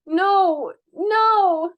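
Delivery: frightened denial. Acted. Prisoner shrinks back in fear.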